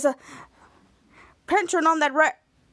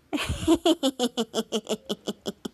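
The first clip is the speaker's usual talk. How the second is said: long rapid stuttering giggle; acted giggle; 3 semitones lower than usual. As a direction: trail off into a run of giggles. Schwartz giggles mid-explanation.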